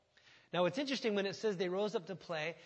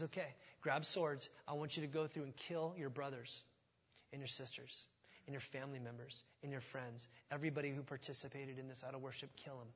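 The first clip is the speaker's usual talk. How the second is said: much softer, lower and grim; grave order; 6 semitones lower, 12 dB quieter than usual. Retold or imitated. Moses orders the Levites to kill, soft and grave. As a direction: say it heavy and hushed.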